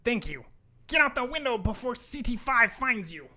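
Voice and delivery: loud, rising to high urgency; urgent elf. Acted. Elf hurrying rat out before the team arrives, urgent.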